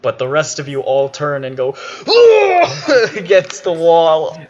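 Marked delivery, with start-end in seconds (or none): 1.8-3.1 loud, high-pitched groan of disgust; revolted outcry. Acted. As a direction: recoil with a loud high "ugh". GM acts players recoiling from stench.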